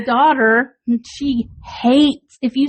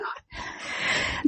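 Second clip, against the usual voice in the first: disgusted whisper; 14 dB quieter than usual. Retold or imitated. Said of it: "she's just like".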